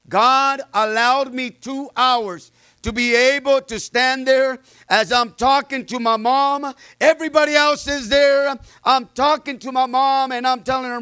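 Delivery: impassioned sermon delivery, building; preaching. Acted. Pastor testifying to the church, preaching with fervor. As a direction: preach it to the congregation.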